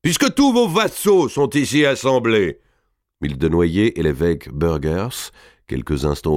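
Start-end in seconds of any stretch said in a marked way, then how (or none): 0.0-2.6 high, ringing voice of a proud giant lord; booming Robert. A narrator's reading. Robert addresses the king before his vassals, high and proud.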